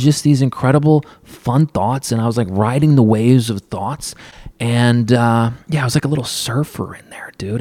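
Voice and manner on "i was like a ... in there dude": soft whispered aside; hushed surfer whisper. Real, not acted. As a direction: drop to a whisper, dreamy. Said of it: "whispered aside".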